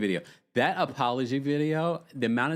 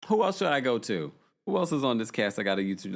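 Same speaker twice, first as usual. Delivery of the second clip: ordinary talk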